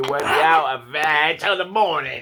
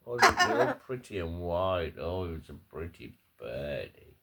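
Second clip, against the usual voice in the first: soft cooing; 6 semitones lower, 7 dB quieter than usual. Real, not acted. Cooing at parrot.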